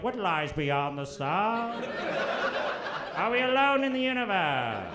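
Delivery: slow, grand documentary narrator parody; hammed-up awed narration; space documentary narrator. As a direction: wonder aloud like a Netflix space host. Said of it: "space documentary guy in Netflix".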